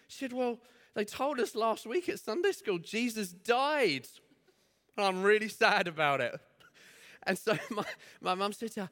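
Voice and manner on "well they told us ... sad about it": put-on little girl voice.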